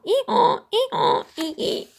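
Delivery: high, loud hee-haw bray; donkey bray. Acted. Puzzle braying aloud for joy, high loud bray. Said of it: "gave a grand ee-aw".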